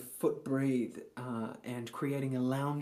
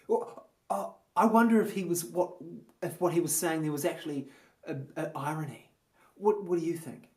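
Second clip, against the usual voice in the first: higher, louder, hesitant put-on musing; pretend intellectual pondering; 4 semitones higher, 6 dB louder than usual. Someone round the rehearsal circle debating Shakespeare, mock thoughtful. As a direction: wonder aloud, hammed-up, then toss it back. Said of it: "someone might say".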